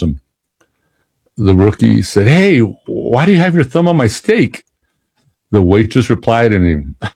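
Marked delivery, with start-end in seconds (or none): 2.3-4.6 high, startled indignant question; indignant surprise. Retold or imitated. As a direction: ask it shocked and offended. Rookie confronting waitress.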